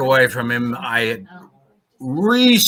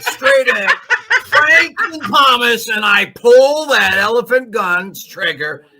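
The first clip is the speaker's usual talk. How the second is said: excited; 8 semitones higher than usual. Real, not acted. Player narrates firing, excited.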